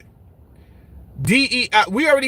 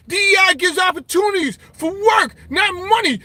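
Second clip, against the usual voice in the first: mocking high voice; 10 semitones higher than usual. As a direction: shout it like a smug know-it-all. Mock-reading a hostile chat comment.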